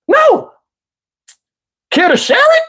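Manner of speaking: very high, mock-curious lady voice; irritating woman. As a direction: ask sweetly in an absurdly high mimicry. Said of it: "voice to people that irritate me".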